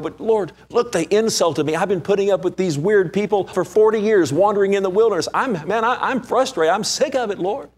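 high, loud frustrated whine; frustrated complaining. Pretend. Moses complains to the Lord, high and loud, frustrated. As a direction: whine it up high like a fed-up man. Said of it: "Moses didn't go".

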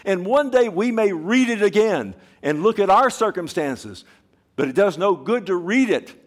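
high, loud urging; impassioned preaching. Real, not acted. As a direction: plead with the whole room. Preacher pleading with congregation.

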